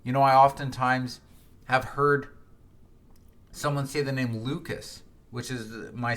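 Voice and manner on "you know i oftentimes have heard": usual speaking voice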